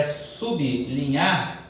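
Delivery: "sublinhar" as sub(i)-li-nhar; correct pronunciation. Shown as a demonstration. Teacher explains the split from the sound, "sublinhar" said sub-linhar. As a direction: separate b from l in "sublinhar". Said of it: "Como a pronúncia é".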